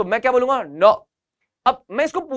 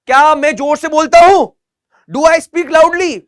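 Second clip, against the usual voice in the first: higher, louder classroom call; loud model question; 5 semitones higher, 8 dB louder, slower than usual. Shown as a demonstration. (ऊँची, ज़ोरदार आवाज़ में सवाल)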